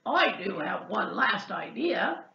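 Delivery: lightly piggy voice; a hint of an oinking pig; oinked. Pig offering a last idea, lightly oinking. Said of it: "oinked Pig".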